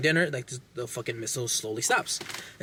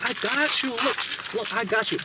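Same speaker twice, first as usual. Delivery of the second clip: high, put-on sly reassurance; mock villain voice; 8 semitones higher than usual. Villain to the henchman, high mock reassurance. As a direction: fake the friendly killer, pitched way up.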